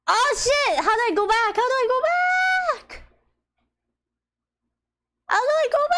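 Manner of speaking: panic, you clicked the wrong thing; panicked shout. Real, not acted. Panicking after misclick.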